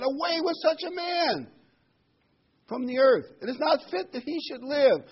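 high, loud mob yelling; angry crowd shout. Acted. The Jerusalem crowd shouting Paul down, high and loud. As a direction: roar it out like a furious crowd. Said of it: "lifted up their voice and said".

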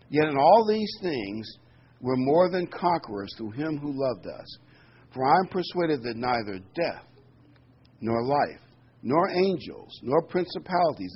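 solemn scripture read. Acted. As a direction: read the verses out to the congregation with weight. Reading scripture aloud.

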